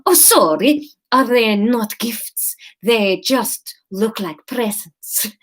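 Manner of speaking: put-on slow Spanish-Italian accent, apologetic; Italian-sounding accent. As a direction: assistant backtracking to Kate, Italian-ish accent. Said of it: "this is more Italian".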